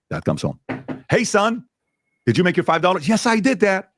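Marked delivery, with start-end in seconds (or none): 1.1-3.1 dad voice. Retold or imitated. Dad voiced in retold story. 3.1-3.9 son voice. Retold or imitated. Son voiced in retold story.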